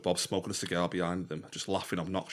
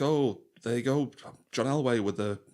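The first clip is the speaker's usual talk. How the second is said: mock commentator; 4 semitones higher, 3 dB louder than usual.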